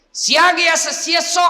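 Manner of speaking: high, loud pulpit voice; fiery preaching. Acted. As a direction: preach it with full force. Preaching at full force.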